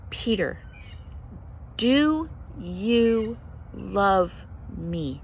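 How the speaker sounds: drawn-out, slow, earnest question; slow solemn question. Retold or imitated. Jesus looking Peter in the eye, slow. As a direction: stretch every word slowly. Jesus asks a third time.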